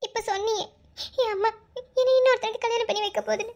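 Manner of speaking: high, weepy and pleading; crying. Acted. Girl telling her lover about the forced marriage, crying. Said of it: "இப்ப எதுக்கு அழுகுற?".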